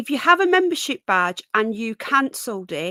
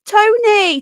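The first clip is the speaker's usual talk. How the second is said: high, loud bright hello as if to a friend; chirpy mock greeting; 9 semitones higher, 8 dB louder, much slower than usual. Retelling her Facebook message, a high loud mock hello. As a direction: sing out the greeting, hammed up. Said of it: "I'm like".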